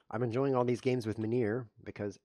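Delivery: ordinary delivery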